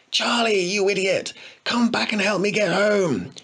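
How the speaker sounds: high, shrill screech down the street. A narrator's reading. Boy screeching at runaway friend, high cry. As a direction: screech after your friend. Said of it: "he screeched to the street".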